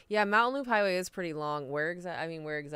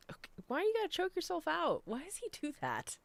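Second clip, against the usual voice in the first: high, soft fussing voice; fond scolding; 11 semitones higher, 5 dB quieter than usual. Real, not acted. Speaker to a pet choking itself, high soft fussing. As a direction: fuss over a pet in a high little voice.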